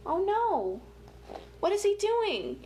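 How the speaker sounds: normal talking voice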